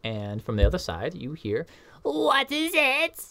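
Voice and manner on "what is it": high, slow suspicious call through the door; eccentric professor. Acted. Professor calling to students through the door. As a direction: call out warily from behind a door.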